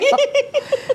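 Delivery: quick bright laugh; a brief laugh with the host; short high laugh. Real, not acted. Laughing along at the host's lipstick joke.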